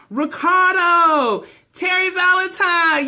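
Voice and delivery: happy shout-out to a viewer joining the stream; excited drawn-out greeting. Real, not acted. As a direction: call the name out long and loud.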